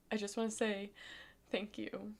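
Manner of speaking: soft, tearful thank-you, voice nearly gone; fighting back tears while thanking her; real crying, barely holding it together; choked-up thanks. Real, not acted. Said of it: "I don't know why I'm so emotional".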